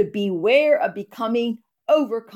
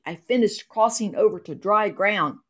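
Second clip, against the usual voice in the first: normal talking voice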